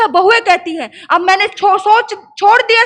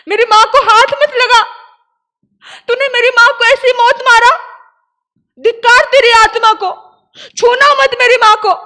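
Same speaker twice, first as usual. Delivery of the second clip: loud, high, raging rebuke; furious outburst; 6 semitones higher, 6 dB louder than usual. Acted. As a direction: scream at the brother to keep away. Sister curses brother over mother's body.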